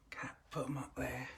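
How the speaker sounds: whispered aside to Cat. Real, not acted. Telling Kat quietly where to put the eggs, whispered. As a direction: hush it so the house stays quiet.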